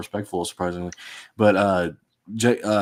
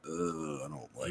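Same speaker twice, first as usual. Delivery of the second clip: much softer, a queasy low mumble; uneasy mutter; 4 semitones lower, 16 dB quieter than usual. Retold or imitated. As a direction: murmur it like a bad feeling sinking in. Retelling own uneasy reaction.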